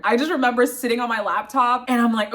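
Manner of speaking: usual speaking voice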